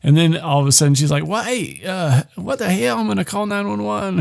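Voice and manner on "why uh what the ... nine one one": high, soft mock Australian woman; Australian accent. Woman reacting to caller's attack, high put-on Australian accent. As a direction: imitate a startled Australian, pitched well up. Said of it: "that's my real voice".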